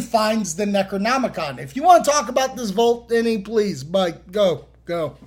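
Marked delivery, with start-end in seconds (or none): none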